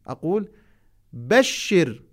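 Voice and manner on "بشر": بشر said short, ending on the r; masculine بشر. Shown as a demonstration. Teacher contrasts بشر with the feminine form. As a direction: clip بشر cleanly, no final vowel. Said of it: "حينما أخاطب الذكر ماذا أقول".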